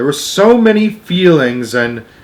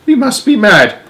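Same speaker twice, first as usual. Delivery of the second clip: high, loud panicked cry; screaming; 11 semitones higher, 5 dB louder than usual. Acted. Grandma Josephine skidding to a stop, high loud scream. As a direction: shriek in sudden alarm. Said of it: "she screamed".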